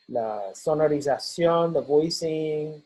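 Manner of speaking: usual speaking voice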